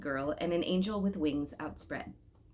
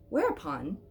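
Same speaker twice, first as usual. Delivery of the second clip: plain reading voice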